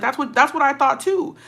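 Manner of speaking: pitched high and outraged; can you believe it, rising up; indignant disbelief. Retold or imitated. Dad to uncle, outraged that grown men bullied daughter.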